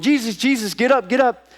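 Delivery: high, urgent wake-up plea; acted panic. Disciples waking sleeping Jesus in the storm, acted panic. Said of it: "I'm sure they're in a panic mode".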